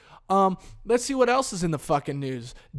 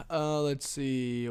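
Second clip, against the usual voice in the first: usual speaking voice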